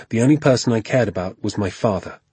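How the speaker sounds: plain reading voice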